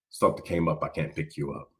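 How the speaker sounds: a dad letting his son down quietly on the phone; father cancelling. Retold or imitated.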